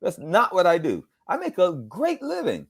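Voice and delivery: high-pitched mock protest. Voice actor refusing colleague's dare to do impressions, protesting.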